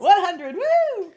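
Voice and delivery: loud, high cheer with a laugh; excited cheer. Real, not acted. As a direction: whoop in celebration. Hundredth episode celebration.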